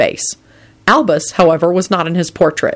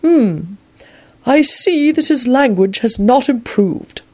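put-on old wizard voice; 8 semitones higher, slower than usual. A narrator's reading. Dumbledore reading note.

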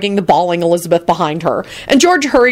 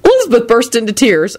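ordinary delivery

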